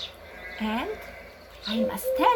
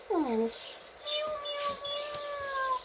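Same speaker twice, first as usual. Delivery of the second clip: cat meow; 6 dB quieter, much slower than usual. Shown as a demonstration. Imitating cat for children.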